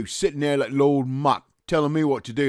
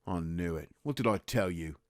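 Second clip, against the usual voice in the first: low, soft told-you-so; muttered vindication; 6 semitones lower, 9 dB quieter than usual. Acted. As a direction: mumble it to yourself. Private mutters, proved right.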